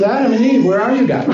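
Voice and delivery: high, casual call to missing friends; friendly calling out. Retold or imitated. God looking for his people to walk with, friendly calling out. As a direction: call out for company you've been looking for. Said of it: "He wants to be with them".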